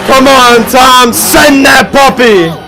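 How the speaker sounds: amped-up arena play-by-play; sports commentary. Acted. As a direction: call it like a live announcer. Live play-by-play over show footage.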